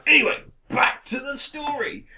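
hammed-up return to the tale; theatrical segue. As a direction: swoop up high to announce the story. Theatrically resuming the recap.

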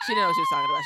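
high playful shout. Real, not acted. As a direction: yell it out teasing. Shouted joke accusation.

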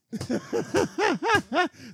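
high squealing laugh; high laughter. Real, not acted. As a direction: burst out laughing.

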